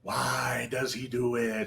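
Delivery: a hushed, spooky showman's whisper; hushed mock mystery. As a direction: murmur it as a mysterious aside. Performer narrates own mystique.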